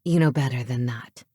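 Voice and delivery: low male reprimand; chiding. A narrator's reading. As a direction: scold coolly in a deep pitch. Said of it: "he chided".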